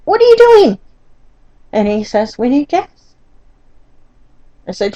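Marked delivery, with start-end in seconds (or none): 0.1-0.8 high, loud, exasperated yell; incredulous shout. Retold or imitated. Wife yelling at husband at the gas station, high loud disbelief. 2.4-3.1 mimicking husband. Husband voiced in retold quarrel.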